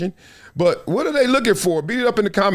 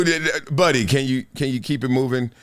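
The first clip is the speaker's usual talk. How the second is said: low-key, halting appeal; polite request to move along; 6 semitones lower than usual. Acted. Acting out asking heckler to move.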